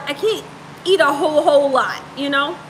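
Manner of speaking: ordinary delivery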